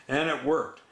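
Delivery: usual speaking voice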